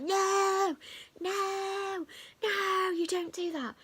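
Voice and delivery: high, insistent mock telling-off; a high "no, no, no", slowing on the last part; imitating scolding. Instructor scolding the learner for giving way, high mock scold.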